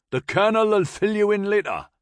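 high, loud Geordie shout; shouting in Newcastle accent. A narrator's reading. Corporal promising the major a briefing, shouted in Newcastle accent. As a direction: shout it back in broad Newcastle. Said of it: "Tip shouted back".

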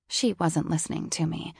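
plain reading voice

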